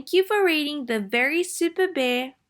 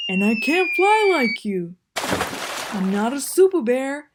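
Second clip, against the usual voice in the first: Bear's voice; 3 semitones higher, 5 dB louder than usual. Acted. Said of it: "he said".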